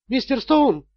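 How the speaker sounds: high, formal telephone operator; bankruptcy department clerk. A narrator's reading. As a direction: announce formally, like a phone receptionist. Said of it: "услышал он другой голос". (официальный высокий голос служащей)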